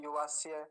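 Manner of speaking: युवास्य run off quickly with indistinct य; wrong hurried ending. Shown as a demonstration. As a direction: slip over the last syllable of युवास्य. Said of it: "युवास्य नहीं".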